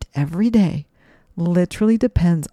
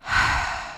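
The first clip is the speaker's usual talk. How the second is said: demo sigh; 4 dB quieter than usual. Shown as a demonstration. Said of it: "and sigh it out".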